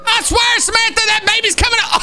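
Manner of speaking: excited shout. Pretend. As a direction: shout it out mid-chase, high-pitched. Cop chasing pregnant suspect.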